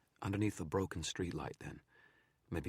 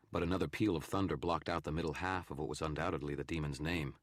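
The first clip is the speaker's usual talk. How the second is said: ordinary delivery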